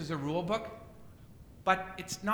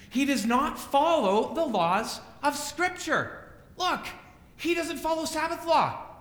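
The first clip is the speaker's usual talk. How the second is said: higher, louder mock denunciation, slowing on key words; voicing accusing Pharisees; 6 semitones higher, 4 dB louder than usual. Preacher voices Pharisees warning the crowd away, raised and accusing. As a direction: play the religious teachers condemning Jesus. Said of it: "and they say".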